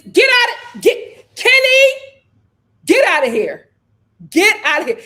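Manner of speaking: loud disbelieving yell; shouting at the screen. Real, not acted. Yelling at Kenny on the screen, loud and exasperated. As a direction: yell at the TV to get out.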